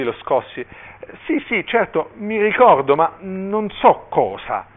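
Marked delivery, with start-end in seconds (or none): 1.2-4.7 high voice, dragging out the last words; muddled hesitation. A narrator's reading. Dazed bishop questioned by the frantic father, muddled and high. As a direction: stretch the admission as memory fails.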